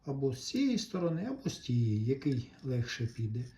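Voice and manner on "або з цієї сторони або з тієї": usual speaking voice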